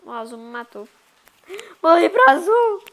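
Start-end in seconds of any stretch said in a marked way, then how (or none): 1.8-2.8 loud complaint. Real, not acted.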